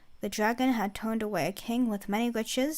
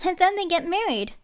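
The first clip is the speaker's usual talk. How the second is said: put-on high, louder girlish blurt; excited interruption; 8 semitones higher, 7 dB louder than usual. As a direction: cut in with twinkling delight. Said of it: "Anise interrupted, her eyes twinkling".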